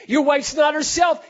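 loud, high preaching cry; emphatic shout. Real, not acted. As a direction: press the point on husbands. Preacher urging husbands.

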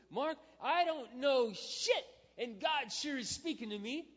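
hammed-up imitation of her salty excitement; blunt new believer. Pastor mimicking Sally calling about her Bible, loud imitation. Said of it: "and she goes".

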